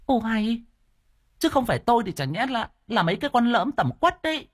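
a wife ticking off her foolish husband; high exasperated woman voice; indignant wife voice. Acted. Wife scolds the men.

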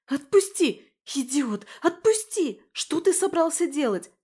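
put-on high, loud, frantic yelling of a struggling girl; girl screaming to be put down. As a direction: scream it at him while kicking to get free. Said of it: "кричала она".

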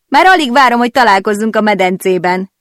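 high, loud teasing invitation with a wink; flirty tease. A narrator's reading. Beatrice teasing Nora about the pool, high and playful. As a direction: sing it out playfully, all wink. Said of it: "kacsintott rám".

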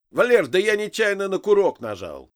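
high, loud, defensive call; flustered yell of explanation; shouted excuse. A narrator's reading. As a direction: shout back up to the boss defensively. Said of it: "крикнул первый".

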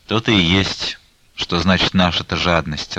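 ordinary delivery

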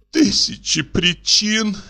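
high, slow, tearful sob on the words; sobbing echo. A narrator's reading. Olga throwing his words back at the painter, sobbing. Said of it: "всхлипнула Ольга Ивановна".

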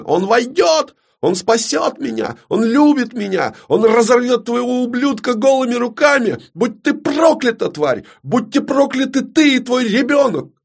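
very high, hysterical screeching; shriek. Acted. Далина curses the queen and her child, shrieking. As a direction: shriek it as a curse, pitched right up. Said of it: "завизжала Далина".

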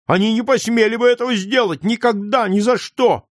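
put-on a boy choking on sobs of fury at the injustice; indignant sobbing boy. A narrator's reading. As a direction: cry out against it, voice cracking with held-back sobs. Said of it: "рыдая и громко выражая своё негодование".

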